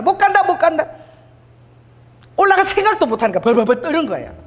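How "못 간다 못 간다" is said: high-pitched mock wail of refusal; retold wailing. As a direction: imitate people crying that they can't go. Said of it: "그들은 다 울은 거예요". (우는 백성 흉내)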